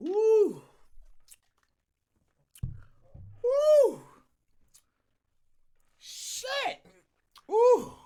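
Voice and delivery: high pained ooh from the heat; mouth burning from spicy food. Real, not acted. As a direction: yelp at the spicy burn. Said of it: "now my throat is burning".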